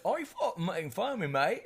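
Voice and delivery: high, fast mock English accent; hammed-up English accent. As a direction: put on an indignant English bloke. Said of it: "Some kind of English shit came out".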